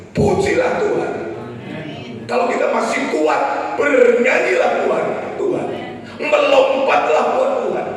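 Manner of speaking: high, loud shouted exhortation; rousing preacher's cry; full-force preaching. Real, not acted. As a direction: urge the congregation to praise at the top of your voice.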